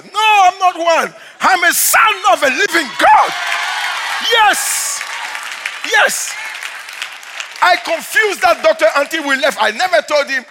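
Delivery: shouted, high, fervent preaching; preaching at full force. Acted. Pastor shouting his testimony to the congregation, full-force preaching. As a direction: proclaim it to the whole church.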